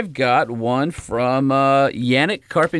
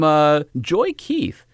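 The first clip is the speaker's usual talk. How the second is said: ordinary talk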